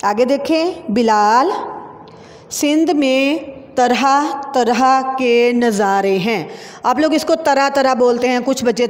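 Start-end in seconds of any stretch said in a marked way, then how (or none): 2.5-6.5 slow, word by word; slow classroom dictation; slow dictation reading. Shown as a demonstration. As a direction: read it slowly for the children to write.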